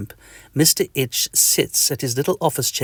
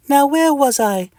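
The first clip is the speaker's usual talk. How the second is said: high, cheery tiny-creature greeting; friendly little itch; 4 dB louder than usual. Acted. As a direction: greet your neighbour brightly and squeakily. Mister Itch voice.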